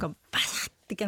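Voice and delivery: breathy hushed sound-effect voice; lightly whispered bashatte; whispered snip. Pretend. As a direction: hush it like the snap of shears. Mimicking the snip of shears.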